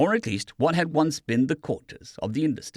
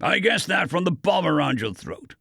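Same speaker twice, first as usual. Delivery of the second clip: lightly voiced dry remark; a touch of dry sarcasm; 6 semitones higher, 3 dB louder than usual. A narrator's reading. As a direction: say it bone dry, unimpressed. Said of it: "Vincent said dryly".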